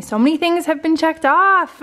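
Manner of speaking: high, loud burst of excitement; giddy and loud, real delight; excited celebration. Real, not acted. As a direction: exclaim it, thrilled at the progress. Celebrating goals just checked off.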